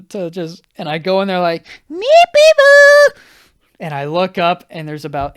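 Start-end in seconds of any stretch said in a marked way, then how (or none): 1.9-3.2 very high, loud drawn-out squeal; gleeful cat call. Retold or imitated. Walking into sister's room to the cat, squealed greeting. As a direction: sing out the pet's name at full volume.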